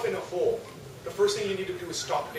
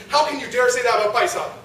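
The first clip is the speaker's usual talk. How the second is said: raised, angry rebuke; indignant defense; 3 semitones higher, 6 dB louder than usual. Retold or imitated. Driver rebukes the host for insulting Bhai Sahib, angry. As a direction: snap back in outrage on a friend's behalf. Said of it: "got mad and starts defending".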